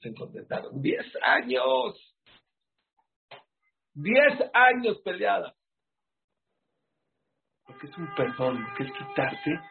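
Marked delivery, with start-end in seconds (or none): 0.8-5.5 "Diez años" drawn out slow and higher; stress on ten years. Acted. Preacher to congregation, slow stressed "Diez años". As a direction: linger on "Diez", marveling.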